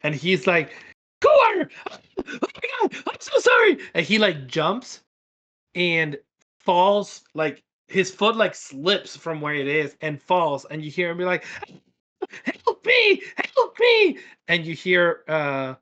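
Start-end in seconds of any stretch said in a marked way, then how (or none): 1.2-3.8 very high, startled kobold squeal; high-pitched panicked kobold. Acted. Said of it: "this kobold jumps. Like, he is not expecting you". 12.6-14.2 very high, loud creature shriek; high-pitched panicked kobold scream. Acted. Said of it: "you just hear him scream".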